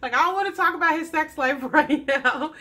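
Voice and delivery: high-pitched mock indignation, breaking into laughs; appalled mom. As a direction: act out a scandalized mother, amused. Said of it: "you can see her face like".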